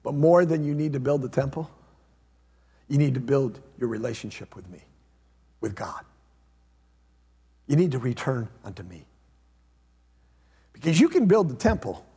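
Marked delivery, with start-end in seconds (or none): 0.0-9.0 much lower and softer, tender and sincere; gentle plea. Retold or imitated. Zechariah calling the people back, soft and tender. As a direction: speak it low like a loving call home. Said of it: "Zechariah comes right in the middle and says".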